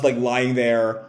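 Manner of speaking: usual speaking voice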